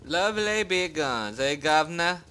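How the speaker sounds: a lightly faked British accent; bad British accent. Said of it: "the worst British accent I ever did".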